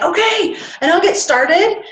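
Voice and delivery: mock eager. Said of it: "if you're anything like me, it's like".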